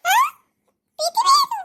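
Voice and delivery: high, loud startled question; shocked disbelief. Acted. Mother-in-law learns the bahu drinks, shocked. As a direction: react in loud, rising shock.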